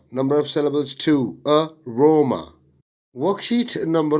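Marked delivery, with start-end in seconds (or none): 1.5-2.5 "aroma" split as uh, roma; syllable by syllable. Shown as a demonstration. Teacher dividing worksheet words, "aroma" broken into syllables. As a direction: say "aroma" with a gap after the first syllable. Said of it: "syllable division of the words".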